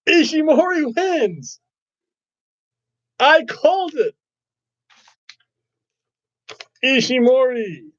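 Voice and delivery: high, drawn-out cheering; excited cheer. Real, not acted. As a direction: cheer the win with delight. Favourite wrestler wins the match.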